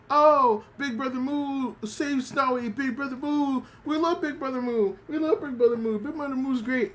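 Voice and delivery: high, sweet puppy chant; adoring puppies. Retold or imitated. Little Shiba Inus cheering Big Brother Moo, high and adoring. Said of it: "they're just being like".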